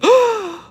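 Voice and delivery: sudden high gasp of surprise; sharp gasp. Real, not acted. Streamer gasps at a chance for a drive. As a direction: gasp as an idea hits.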